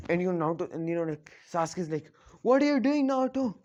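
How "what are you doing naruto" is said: high, loud panicked yelp; scared cry. A narrator's reading. Sasuke seeing Naruto rip off his arm, panicked high cry. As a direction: cry out in alarm, pitched way up. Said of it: "completely scared".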